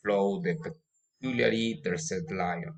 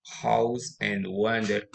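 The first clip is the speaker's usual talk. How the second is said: usual speaking voice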